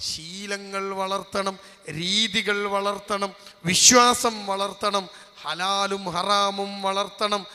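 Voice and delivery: stretched, insistent refrain; emphatic preaching list. Real, not acted. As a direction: hammer each item home slowly. Preacher hammers a repeated list.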